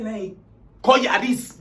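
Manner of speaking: normal talking voice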